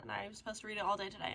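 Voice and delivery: normal talking voice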